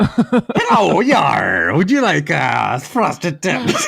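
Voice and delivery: mock pirate hawking frosted tips, through giggles; pirate accent, played for laughs. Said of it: "He was also pi-, pirate".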